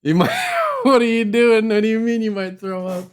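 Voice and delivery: loud, high words breaking into laughter; incredulous and cracking up; laughing disbelief. Real, not acted. Husband laughing at wife who feels sick, laughing.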